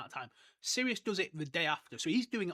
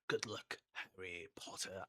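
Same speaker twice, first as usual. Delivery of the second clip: low, slow film Dumbledore; Richard Harris; 9 semitones lower, 10 dB quieter, slower than usual. Retold or imitated. Imitating Dumbledore's goodbye in the movie, solemn and slow. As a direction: drop low and draw out the name, softly. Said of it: "I actually prefer the movie where it's like".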